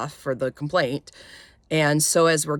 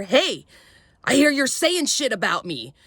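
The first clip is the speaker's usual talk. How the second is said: higher-pitched retold angry callout; angry confrontation; 7 semitones higher than usual. Flight attendant confronts passenger who complained about him, angry. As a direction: imitate an angry man confronting her.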